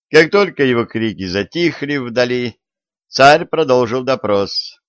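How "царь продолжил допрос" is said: ordinary delivery, nothing marked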